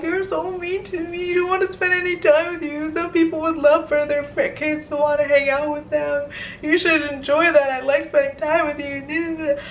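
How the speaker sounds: high, whiny mock sob to mom; imitated crying plea. Daughter pleading tearfully with her mom on the phone, high mock whine. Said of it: "I just started bawling and crying".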